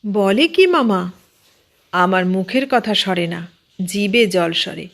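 plain reading voice

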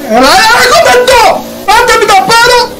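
frantic laughing plea; excited yelling. Real, not acted. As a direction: yelp it as the car slides. Car about to crash, yelling.

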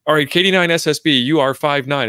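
high, impatient, repeated demands; whiny, exasperated radio operator; cranky operator. Retold or imitated. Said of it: "they get cranky about is like".

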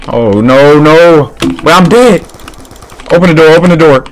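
high, loud panicked yelling. Real, not acted. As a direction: scream for the door to open. Dying in the game.

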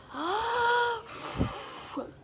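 huge gasping inhale; big wolf breath in. Shown as a demonstration. Teacher modelling wolf breathing for the class, big gasp in. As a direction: suck the air in like the big bad wolf. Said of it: "pretend to be the big bad wolf and go".

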